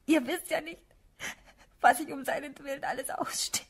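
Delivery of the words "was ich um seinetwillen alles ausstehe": ordinary delivery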